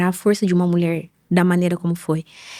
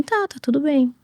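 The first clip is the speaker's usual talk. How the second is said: higher, lightly imitated reassurance; putting on okay; 7 semitones higher than usual. Ill mother telling her daughter all is well, putting on okay. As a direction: brush off worry in a lifted, softened voice. Mother feigning being fine, retold.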